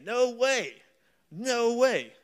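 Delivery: Yankee voice. Pretend. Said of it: "Let me give the Yankee translation".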